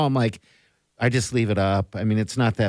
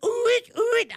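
high squeaky bird call attempt; failed bird call. Shown as a demonstration. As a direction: try the bird whoop and miss. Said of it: "I can never do that sound".

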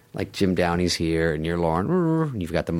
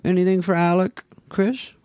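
a higher, louder mock Lorne calling on Farley; Lorne's pitch-meeting question; 10 semitones higher, 5 dB louder than usual. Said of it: "And he's like".

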